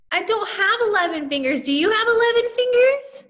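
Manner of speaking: high, loud mock surprise; teacher jokingly asking about fingers; playful disbelief. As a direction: tease the kids.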